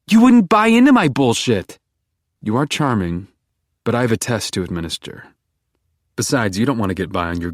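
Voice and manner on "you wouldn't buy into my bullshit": much higher, louder desperate plea; Beck pleading. A narrator's reading. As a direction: beg him to stop. Beck flirting.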